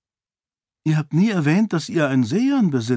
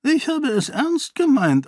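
ordinary delivery

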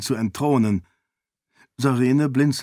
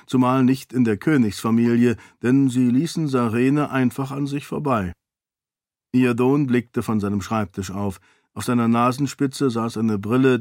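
ordinary talk, nothing marked

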